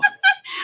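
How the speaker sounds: shrieking burst of laughter; high-pitched laughing shriek. Real, not acted. Host sees the wig reveal.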